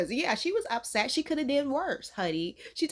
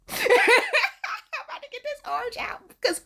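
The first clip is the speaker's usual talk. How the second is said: talking through laughter; laughing; 11 semitones higher, 3 dB louder, slower than usual. Real, not acted. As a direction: say it while laughing.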